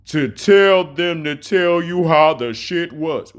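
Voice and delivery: very slow, drawn-out mocking read; slow motion reading. Shown as a demonstration. Slowing the message down for viewers, mocking drawl. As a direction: stretch every word out to mock him. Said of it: "let me slow this down so you can see".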